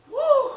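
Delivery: loud, high puffed-out whoop; winded "woo". Retold or imitated. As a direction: imitate catching your breath with a big "woo". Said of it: "I was out of breath".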